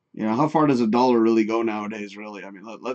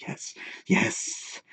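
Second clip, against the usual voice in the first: whisper; 7 semitones higher, 9 dB quieter than usual. Pretend. Creepy whispered reply.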